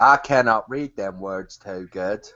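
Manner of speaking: slow put-on simpleton voice; dim yokel. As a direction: plod along as a poor reader. Mock illiterate hick joins the bit.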